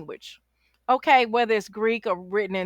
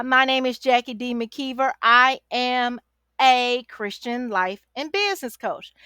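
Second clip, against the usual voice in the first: ordinary talk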